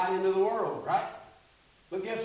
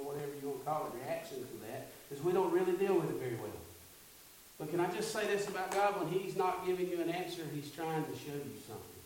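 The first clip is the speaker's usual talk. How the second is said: normal talking voice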